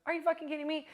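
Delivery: high, disbelieving outburst; are you serious, in disbelief; stunned, scoffing disbelief; incredulous. Retold or imitated. Her reaction to women's excuse.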